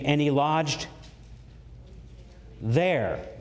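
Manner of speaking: long pause before a falling "there"; "there" isolated for emphasis; stress on THERE. Shown as a demonstration. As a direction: hold back, then drop "there" alone. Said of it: "put my emphasis on it here".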